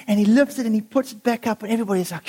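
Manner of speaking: normal talking voice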